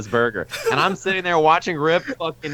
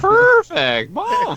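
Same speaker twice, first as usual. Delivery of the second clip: much higher and louder squeal of Perfect; mock announcer perfect; 4 dB louder, much slower than usual. Friend mimicking the game voice saying perfect, loud high squeal.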